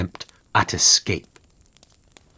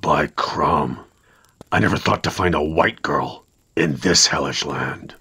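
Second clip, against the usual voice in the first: gruff warrior; 6 semitones lower than usual. A narrator's reading. Warrior voiced by narrator.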